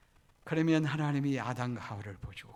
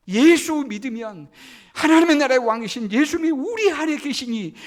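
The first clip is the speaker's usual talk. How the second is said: loud, high, ringing sermon climax; fiery preacher crescendo; preaching at full force; 9 semitones higher, 15 dB louder than usual. Acted. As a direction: proclaim it to the whole church.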